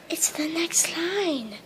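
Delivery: hushed stage whisper. Real, not acted. Friend whispers the next line to Angelina. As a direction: whisper the cue under your breath. Friend prompting her quietly onstage.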